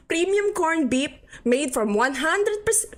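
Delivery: high, slow mock Filipino advert; terrible Filipino accent. Streamer reading out the can, terrible Filipino accent. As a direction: drag out the pitch in a bad Filipino accent. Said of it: "That was some terrible Filipino accent".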